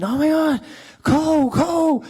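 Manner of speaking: imitating squealing fans, high and loud; excited fans. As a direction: call out like a starstruck fan. Excited fans running up to him.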